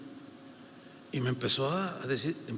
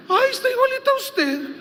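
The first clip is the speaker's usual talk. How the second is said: much higher, louder imitation of a gushing sister; high woman's voice; 8 dB louder than usual. Sister tells the pastor the baby looks like him, high mock voice. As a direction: squeal it like the church sister.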